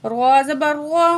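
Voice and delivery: ordinary talk, nothing marked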